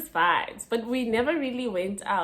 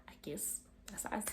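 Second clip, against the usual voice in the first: whisper; 14 dB quieter than usual. Real, not acted. Aside, whispered.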